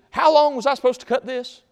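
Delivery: high, loud shouted question; calling to a coworker. Acted. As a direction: holler a question across a building site. Carpenter calls out to the engineer.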